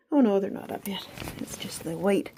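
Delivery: breathless from climbing stairs; puffing between words; out of breath. Real, not acted. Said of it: "I'm sorry I'm a little out of breath".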